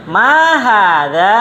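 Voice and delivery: teacher saying Ma hadza for the class to echo; teacher drill. Shown as a demonstration. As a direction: model the phrase slowly for repeating. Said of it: "sekarang ikutin ana lagi".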